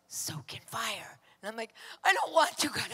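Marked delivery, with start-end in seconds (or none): 0.1-1.2 very soft, slow whisper; God's whispered voice. Retold or imitated. God repeating the instruction to her, whispered. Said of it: "And he goes". 2.0-2.9 whiny refusal. Retold or imitated. Acted refusal to God.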